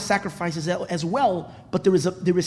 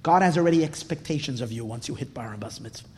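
ordinary talk

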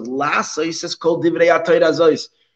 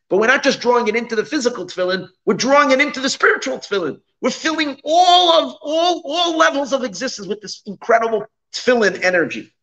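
rising higher and more intense; excited; 7 semitones higher than usual. Real, not acted. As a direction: build to an enthusiastic peak. Teacher building to an enthusiastic peak.

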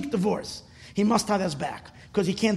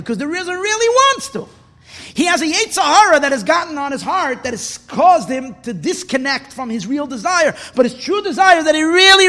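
high, loud, fervent sermon; impassioned preaching; 8 semitones higher, 7 dB louder than usual. Real, not acted. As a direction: preach it with urgent conviction. Preaches at full force.

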